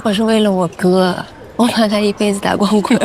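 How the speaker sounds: ordinary talk, nothing marked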